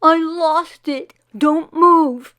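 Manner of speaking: high, loud frantic cry; boy shouting that he lost his tooth, high and loud; frantic child. Acted. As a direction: stop everyone in alarm, high and loud.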